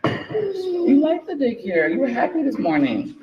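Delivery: high, very slow, gentle reassurance; slow coaxing mom voice. Real, not acted. Mother reassuring her upset toddler, slow and high.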